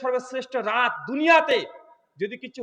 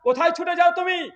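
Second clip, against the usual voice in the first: loud, higher call; calling after him; 7 semitones higher, 7 dB louder than usual. Retold or imitated. Abu Bakr calling after Hanzala, loud call. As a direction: call out after someone running past. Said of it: "Abu Bakr চিৎকার দিয়েছে".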